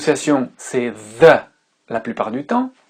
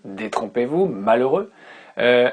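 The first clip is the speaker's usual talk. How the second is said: ordinary delivery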